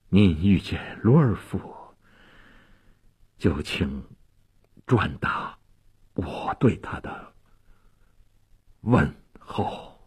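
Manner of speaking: low, weak dying voice with long pauses; halting, fading deathbed voice; trembling, faint. A narrator's reading. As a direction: force out last words, barely voiced. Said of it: "用颤动而微弱的声音说".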